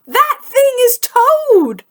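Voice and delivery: high, astonished exclamation; surprised. Acted. Frog recognises Toad, high astonished exclamation. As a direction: gasp it out in amazement. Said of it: "said Frog".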